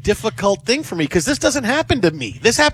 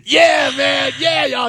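excited reply; 7 semitones higher, much slower than usual. Retold or imitated. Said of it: "I'm like".

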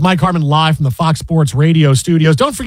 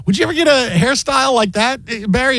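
lightly raised, higher voice playing the anchor; tossing the question to Barry; quoting Bill Pito; 7 semitones higher than usual. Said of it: "Bill Pito says".

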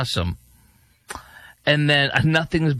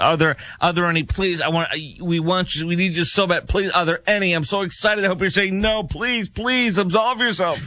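high, hurried pleading, climbing higher; mock begging; 5 semitones higher than usual. Host imitating an interviewer desperate for a no, mock begging. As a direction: plead desperately in an exaggerated high voice, rising as it goes. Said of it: "she's, like, begging".

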